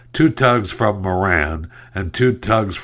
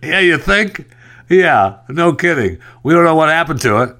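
higher, louder sarcastic jab; sarcastic retort; 7 semitones higher, 3 dB louder than usual. Real, not acted. Host answering the officials' statement, sarcastic. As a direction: needle them, deadpan disbelief.

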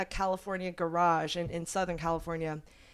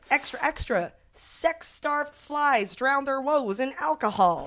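1940s newsreel voice; 7 semitones higher, 4 dB louder than usual. Pretend. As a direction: cry the headline like a newsboy. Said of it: "my best old-timey nineteen forties, uh, voice".

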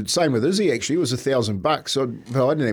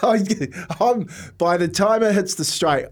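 usual speaking voice

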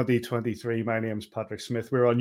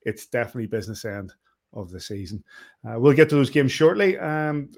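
ordinary delivery

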